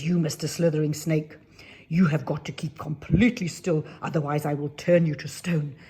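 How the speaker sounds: loud, firm threat building to the end; the fairy warns the scorpion, loud and stern; stern fairy warning. Acted.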